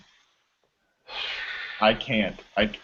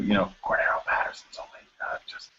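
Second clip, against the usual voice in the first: hushed whisper, secret tip; whisper in your ear; 2 semitones lower, 9 dB quieter than usual. Real, not acted. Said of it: "a little, little whisper into your ear".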